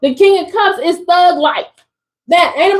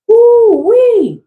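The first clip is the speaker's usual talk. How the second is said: higher, louder "woo-wee"; excited whoop; 8 semitones higher, 4 dB louder than usual. Real, not acted. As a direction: hype up the reading. Hyped reaction to a card.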